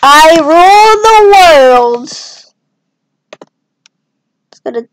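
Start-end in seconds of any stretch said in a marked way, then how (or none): 0.0-2.1 slow, loud mock boast; lightly voiced grand ruler, drawn out; tiny country boasting. UK flag proclaiming it rules the world, mock grand. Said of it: "It's like, yes".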